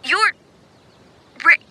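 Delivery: choppy words with long gaps, faking a dropping line; call breaking up. Pretend. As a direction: stop and start like a bad phone line.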